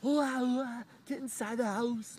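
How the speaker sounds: very high, loud mock wailing; hammed-up shrieking mom; mimicking her mom. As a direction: caricature a mother yelling a child indoors. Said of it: "her mom with her fox ears comes out of nowhere".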